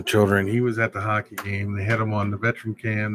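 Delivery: usual speaking voice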